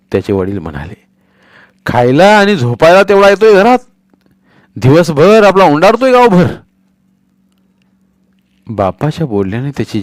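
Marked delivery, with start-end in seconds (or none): 1.9-6.5 angry shout. Acted. Father scolds son.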